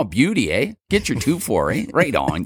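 light Canadian slang voice, "beauty, eh?"; Ontario accent. Retold or imitated. Mocking how Ontario people talk, lightly Canadian. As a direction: play a laid-back Ontario partier. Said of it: "Ontario people have this kind of".

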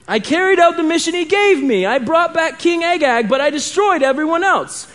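high-pitched self-defence; insistent. A narrator's reading. Saul justifies his mission to Samuel, insistent. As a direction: keep protesting, pitch held well up. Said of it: "Saul insisted".

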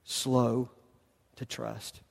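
a hushed, falling slow to trust; fading to near silence. Shown as a demonstration. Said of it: "Quick to forgive".

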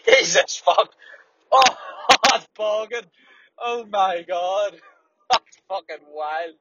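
laughing in real disgust at a stench; real laughing disgust at a foul smell. Real, not acted. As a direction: laugh through the gagging, high and loud at first. Disgusted by manure smell while driving.

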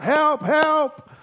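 high-pitched mock cry for help; desperate cry. Fallen hiker calls for help, acted desperate cry. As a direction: call out as if stranded. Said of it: "Jack in his desperation cries out".

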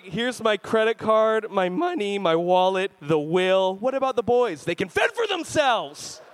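hammed-up doting dad; mock overwhelmed gushing. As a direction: hand over everything in a melted rush. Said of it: "I'm like".

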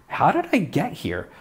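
lightly voiced, lost and confused; a hint of bewildered wondering. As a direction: ask it like you woke up somewhere strange.

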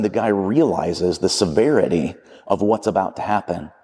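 normal talking voice

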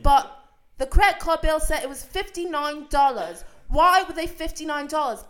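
high, very loud mock-British interrogation; [name] screaming. Boyfriend confronts girlfriend about the credit card bill, screaming in British accent. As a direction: demand an answer, yelling in a put-on British accent. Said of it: "I would say more screaming".

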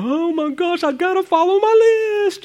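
high, fretful voice for the list-maker; mock anxious list-follower. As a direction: panic like an OCD rule-follower over the list. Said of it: "you're like".

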